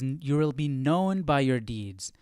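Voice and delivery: usual speaking voice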